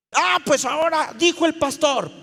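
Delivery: loud, high mock scolding; indignant retort. Server snapping back at a stubborn congregant, indignant. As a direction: throw the pastor's authority at them, loudly. Acted retort invoking the pastor.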